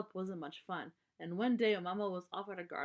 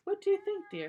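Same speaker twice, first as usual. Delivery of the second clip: much higher, a sing-song ask; sweet question; 12 semitones higher, 6 dB louder than usual. Acted. Mama asks Papa's opinion, in a high sing-song.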